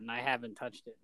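ordinary delivery

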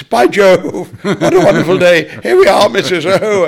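high, loud, giggly comic voice; Doddy impression. Comic imitating Doddy warming up the crowd, high and giggling. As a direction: bubble over like a cheeky music-hall comic. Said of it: "Doddy, it's".

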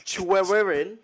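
Welsh in English accent. Shown as a demonstration. Friend battles the Welsh place name, loud English accent. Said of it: "How would you say Pwllgwyngyll in".

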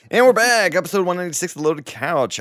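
big excited show intro; announcer. Real, not acted. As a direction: boom it out like a host. Host reopens the show.

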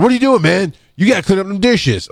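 mock bossy partner; imitating Ronaldo. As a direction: call him out like a blunt senior medic. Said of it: "Ronaldo's like".

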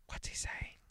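a hushed unvoiced whisper. A narrator's reading. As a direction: breathe the question so no one hears. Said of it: "whispered the columnist".

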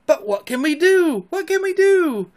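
frantic high-voiced dismay; panicked cry. A narrator's reading. Boys panicking as wolves close in.

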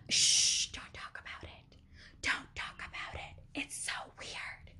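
secretive mock whisper; very soft, scandalised whispering; hushed whisper. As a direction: shush and whisper it like a taboo. Imitating everyone hushing a taboo word.

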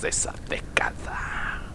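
soft, slow dramatic whisper; whispered ending. Acted. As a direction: hush the last words for effect.

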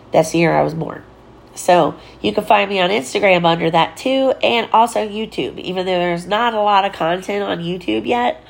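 ordinary delivery

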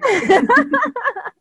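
very high, loud cackling laugh; laughter. Real, not acted. Guest laughing with host, shrieking laughter. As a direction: let a big laugh burst out.

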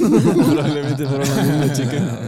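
slow and loud, breaking into laughter; laughing. Real, not acted. Agreeing with a friend about the same girls, laughing hard. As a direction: laugh your way through the line. Cracking up at shared joke.

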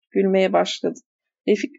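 plain reading voice

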